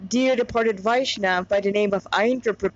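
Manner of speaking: ordinary delivery, nothing marked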